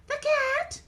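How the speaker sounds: high puppet voice, counting off; Mimi. Acted. Mimi recounts the guests, high puppet voice. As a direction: recite as a tiny character.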